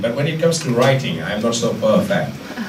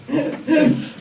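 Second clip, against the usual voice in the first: real laugh. Real, not acted. Unsure answer, laughs it off.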